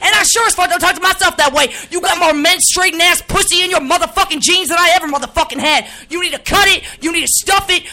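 host blasting a guest on air, furious; furious rant. Acted. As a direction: chew them out hard. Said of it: "This is a real live snap".

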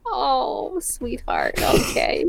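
soft, falling, acted sympathy; soothing mom. Mom consoles frustrated son, soft imitated soothing. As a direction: hush it down to soothe an upset child.

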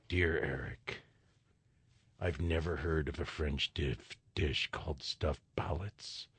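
low, soft letter-reading voice; mock-solemn, quiet and deep; mock listener letter. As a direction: read out a complaint letter in a hushed, low voice. Acts out an imagined complaint letter.

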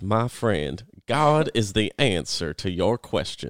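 exaggerated strong Southern preacher drawl; J. Vernon McGee. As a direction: stretch each stressed word in a Southern accent. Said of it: "But that's how he talks".

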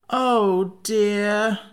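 a high, lightly voiced moan of woe. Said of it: "moaned Jack".

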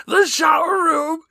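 high, slow all-caps shout; shouting capitalized words. Shown as a demonstration. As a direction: yell it like a crazy all-caps post. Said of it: "I read it in all caps 'cause it feels kinda crazy".

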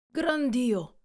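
Montgomery crying out in alarm; startled exclamation. Acted. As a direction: blurt it out in shock. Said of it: "esclamò".

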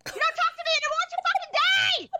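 very high-pitched furious shriek; explosive shrieked outburst; screaming rage. Acted. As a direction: cut them off with an acted scream. Acted screaming at her fiancé.

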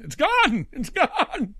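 high, loud cry of disbelief, breaking into a laugh; the house is just gone, say it in shock. Retold or imitated.

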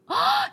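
high-pitched mock gasp of surprise; surprised gasp. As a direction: gasp high, astonished by the gift. Retold surprise at gift basket.

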